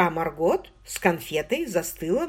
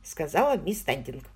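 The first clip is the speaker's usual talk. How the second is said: ordinary delivery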